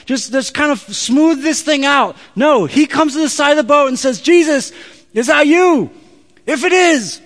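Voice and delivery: high, loud, drawn-out preacher's shout; shouted retelling. Acted. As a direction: belt it out, building to a stretched-out final plea. Preacher shouts out Peter's eager plea.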